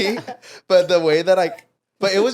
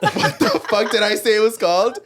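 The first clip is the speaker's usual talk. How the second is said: high, loud incredulous question through laughter; mock-flabbergasted outburst; retold disbelief; 7 semitones higher, 5 dB louder than usual. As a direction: exclaim in baffled disbelief, retelling it. Retold baffled outburst in story.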